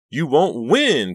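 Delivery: high, loud, drawn-out hysterical wail; sobbed cry. A narrator's reading. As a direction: wail it defiantly through sobs. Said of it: "Karina sobbed".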